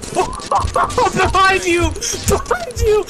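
high, loud shout of warning; urgent warning shout. Real, not acted. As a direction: yell to warn a teammate. Warning friend in game.

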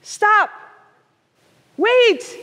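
put-on high, urgent child's call; storyteller's high-pitched shout for a little girl.

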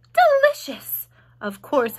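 lightly voiced spider, matter-of-fact and proud; a hint of a brisk spider listing her meals; Charlotte the spider. A narrator's reading. Charlotte answers Wilbur.

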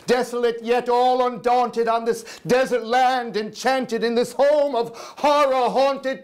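high, urgent imploring; desperate pleading. Acted. Narrator begs the raven for news, in a high urgent plea. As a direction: beg the prophet for an answer.

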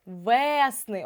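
ВЕСНИ with the first Е drawn out; наголос на Е. Shown as a demonstration. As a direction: lean long on the Е in ВЕСНИ. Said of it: "цей звук став наголошеним". (ВЕСНИ з протяжним наголошеним Е)

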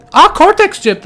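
high, loud burst of surprise; surprised discovery. Real, not acted. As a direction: exclaim at a sudden find. Finds the item. (şaşkınlıkla yüksek sesle)

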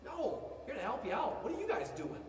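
usual speaking voice

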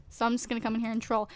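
ordinary talk

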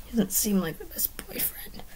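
soft, unvoiced confiding whisper; hushed story whisper. A narrator's reading. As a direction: whisper it as a private aside. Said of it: "Kashim whispered".

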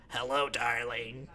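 low, soft, slow "Hello, darling" from a sick throat; croaky old voice. Pretend. Forty-year-old gamer with throat cancer greeting online, soft croak. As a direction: rasp it like a man with throat cancer. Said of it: "this is me when I'm forty".

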